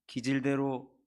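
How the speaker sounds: usual speaking voice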